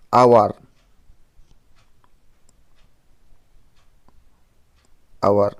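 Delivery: ଆୱାର starting on an open "aa" sound; vowel-first hour. Shown as a demonstration. Teacher shows why hour takes "an", ଆୱାର beginning on "aa". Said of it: "ଆ ଉଚ୍ଚାରଣ ପାଇଲେ".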